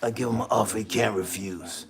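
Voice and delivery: low, quiet mob boss rasp; imitating the Godfather, low and menacing; Godfather impression. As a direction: lean in like the Godfather making a threat. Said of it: "ever watched The Godfather".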